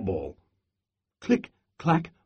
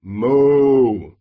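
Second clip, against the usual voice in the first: moo; 6 dB louder than usual. Acted. Cows typing and mooing.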